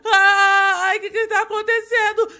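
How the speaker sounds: high, wailing glitch panic; mock panic. Player glitching in the game, in whining mock panic. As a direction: wail it in hammed-up distress.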